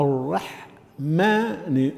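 "الرحمن" very slowly in parts, "ar-rah ... maa-ni"; heavy ra, light ha. Shown as a demonstration. Teacher modelling letter by letter, heavy ra then light ha. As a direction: split "الرحمن" with thick r and thin h and m. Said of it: "بتضخيم الراء".

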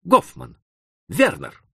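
sudden cry of recognition; surprised exclamation. A narrator's reading. Two friends recognising each other, surprised cry. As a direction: call out the name in surprise. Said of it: "вскрикнули разом".

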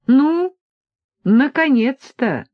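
high, drawn-out happy exclamation; cheerful. A narrator's reading. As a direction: greet them with loud, cheerful relief. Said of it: "весело сказал тот". (весело, радостно, на высокой ноте)

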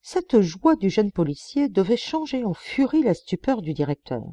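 usual speaking voice

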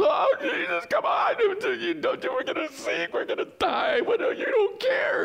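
hammed-up, very high-pitched frantic wail; high, strained panic cry; panicked disciples. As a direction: squeal in mock terror as if the boat is sinking. Acts out panicking disciples in the storm.

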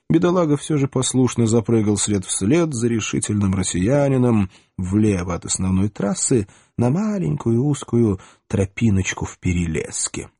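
ordinary delivery, nothing marked

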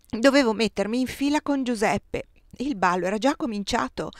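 plain reading voice

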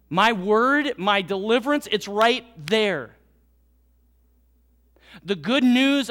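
loud, ringing preacher voice speaking as God; preaching at full force. Acted. Pastor voicing God to the congregation, loud and ringing. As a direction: proclaim it to the whole church.

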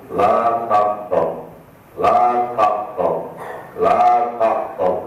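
slow, weighty repeated la taqtab; quoting the Prophet. Retold or imitated. Preacher citing the Prophet's advice, slow and solemn. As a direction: draw out each la taqtab. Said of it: "Rasulullah yang mulia selalu memberi nasihat".

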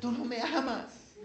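slow, high pouting whine; wounded reproach. Acted. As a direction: drag it out like a sulking lover. Dalila guilt-trips Sansón.

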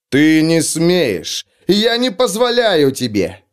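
high, loud impotent rage; sputtering foiled villain. A narrator's reading. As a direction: rage helplessly at the intruder. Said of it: "в бессильной ярости".

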